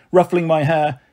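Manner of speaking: ordinary delivery, nothing marked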